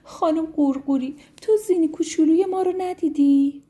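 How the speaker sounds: put-on a mother begging for news of her baby; anxious mother snail. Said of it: "ازش پرسید".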